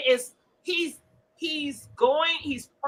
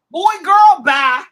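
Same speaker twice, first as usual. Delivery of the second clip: loud dismissal; 3 semitones higher, 9 dB louder than usual. Real, not acted. Ranting host dismisses the women loudly. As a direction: wave them off loudly.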